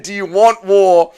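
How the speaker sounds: quoting Max, tearful. Retold or imitated. Streamer quoting Max's challenge, sad. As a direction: echo the challenge sadly. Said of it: "Max said".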